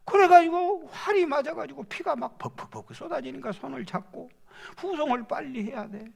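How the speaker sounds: ordinary talk, nothing marked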